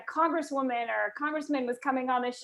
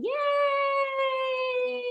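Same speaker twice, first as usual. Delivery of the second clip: high, sung-out yay; signature yay; 13 semitones higher than usual. Shown as a demonstration. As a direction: sing out a long joyful yay. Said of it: "reputation for saying".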